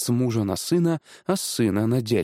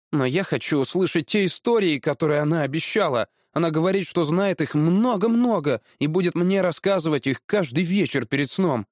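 put-on higher-pitched boy, not giving in; настойчивый мальчик; 6 semitones higher than usual. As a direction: plead your case like a stubborn boy. (упрямый мальчишеский голос)

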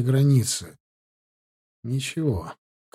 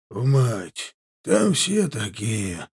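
slow, lightly voiced old highlander's grumble; grumbling old man; 3 semitones lower, much slower than usual. A narrator's reading. Old highlander defending his story, grumbling. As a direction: grumble it out slowly, understated. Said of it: "буркнул старик".

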